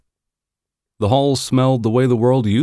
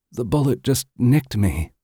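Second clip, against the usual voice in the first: wounded woman; 6 semitones higher than usual. Acted. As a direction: reassure them despite the wound. Wounded woman reassures them.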